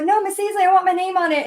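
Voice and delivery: put-on insisting kid.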